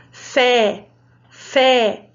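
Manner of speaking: FÉ with a wide open é, drawn out; open-mouthed, held "Fé"; open vowel. Shown as a demonstration. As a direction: say "Fé" letting the open eh sound out. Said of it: "som aberto".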